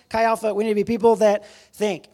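punchy, loud rallying cry; rallying call. Real, not acted. Rallies the crowd, repeating the line.

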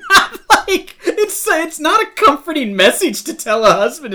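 high, loud laughing protest. Real, not acted. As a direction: object loudly through real laughter. Laughing through objection to retold line.

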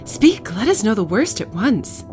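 high, agitated plea; alarmed lady pressing for answers; urgent demand. Acted. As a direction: demand the worst, starting high and loud. Frightened lady demands the news.

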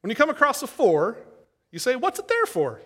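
lightly put-on East Tennessee preacher twang; imitating a country accent. Pastor quoting his saying in a light country accent. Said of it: "country accent and all".

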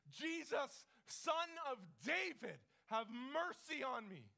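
loud, high, slow plea; desperate scream. Retold or imitated. Blind beggar crying to Jesus through the crowd, acted desperate scream. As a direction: cry out from the depths, imploring. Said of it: "starts to scream from the depths of everything".

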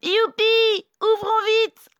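very high, loud squeal of joy; overjoyed little goats. Acted. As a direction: cheer as excited little kids. Kids rejoice at mother's supposed return. (cri de joie des chevreaux)

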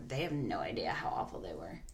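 hushed, low whisper. Real, not acted. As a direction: drop to a whisper. Drops to a whisper.

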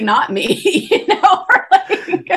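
breaking into real high laughter; real laughter over the words; laughing. Real, not acted. Laughing at her own story.

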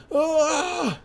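very high drawn-out scream; acted high wail while dropping; falling scream. Heroine falls as string drops, high scream.

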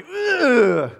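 loud, high drawn-out strain; imitated heaving grunt. Preacher imitating log throwers, heaving grunt.

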